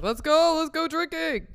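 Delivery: retold eager urging. Brother urging sister to go drinking, eager call. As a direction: imitate someone rallying you to leave.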